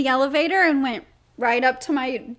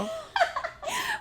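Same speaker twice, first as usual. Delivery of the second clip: high giddy laughter; 8 dB quieter than usual. Real, not acted. Laughing at the joke.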